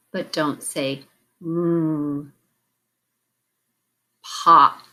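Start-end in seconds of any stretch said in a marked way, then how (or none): 1.4-2.3 mm as a held "rrr", like in brief; isolated consonant sound. Shown as a demonstration. Teacher naming the sound to delete, mm said as a drawn-out "rrr". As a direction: hold the r sound alone for mm. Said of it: "which sound to delete".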